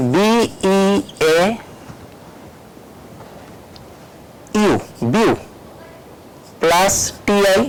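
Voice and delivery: "বি, ই, এ, ইউ" letter by letter with pauses, then "বিউ"; syllable-by-syllable spelling. Shown as a demonstration. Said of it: "তিনটা syllable এখানে এটাকে part করা যায়". ("বি ই এ ইউ, বিউ" থেমে থেমে বানান করে বলা)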